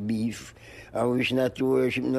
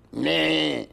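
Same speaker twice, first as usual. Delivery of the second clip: drawn-out, higher goat's "meh"; goat bleat; 4 semitones higher than usual. Retold or imitated. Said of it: "go meh".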